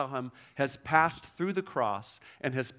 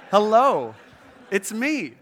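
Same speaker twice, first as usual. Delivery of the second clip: much louder, higher "hello, it's me"; playful hello; 6 semitones higher, 11 dB louder, slower than usual. Retold or imitated. Jesus surprising the women at the tomb, loud and cheerful. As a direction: pop in loudly with a cheerful surprise.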